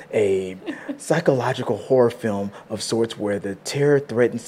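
deep, slow, dramatic trailer narration; low, slow epic voiceover with whispered menace; movie trailer voice. Shown as a demonstration. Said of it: "in the movie trailer voice".

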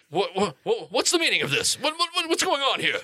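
high, stammering alarm; acted nervous bluster; flustered panic. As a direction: stammer the questions as a bewildered captive, pitch climbing. Game master voices flustered captive.

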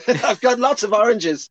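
high laughing put-on voice; laughing mock line; laughing mock offer. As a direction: giggle through the offer. Continues mocking offer, laughing.

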